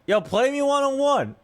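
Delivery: high-pitched mock call-out; imitated challenge shout. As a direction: mimic a kid calling out a challenge. Retold screaming kid issues challenge.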